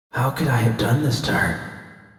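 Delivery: quiet. A narrator's reading. V's guilty inner thought.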